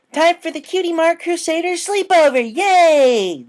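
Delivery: very high shrieking cheer; loud excited fillies. Acted. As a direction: screech it gleefully together. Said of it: "obnoxiously loud unison".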